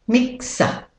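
British-Australian "mixer" ending in "uh"; non-rhotic British-Australian word demo; British-Australian accent. Shown as a demonstration. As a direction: drop the final R of "mixer", British-Australian style. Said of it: "And with the American accent".

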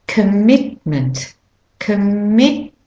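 commitment with a heavier drumbeat on MIT; stress on MIT. Shown as a demonstration. Teacher saying it again, drum beat on MIT in commitment. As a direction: lean hard on the middle of commitment. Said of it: "You can hear that drum beat heavier".

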